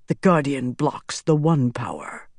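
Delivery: ordinary delivery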